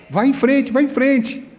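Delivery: high-pitched mock dare to go ahead; desafiando. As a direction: dare them on in a high, goading voice. Acting the woman daring him. (desafio em tom agudo)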